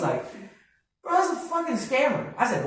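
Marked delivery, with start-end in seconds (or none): 1.0-2.3 high-pitched, incredulous brother imitation; dismissive brother. Brother debunking the scam to his panicked sibling, mock dismissive. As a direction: brush it off in a higher voice.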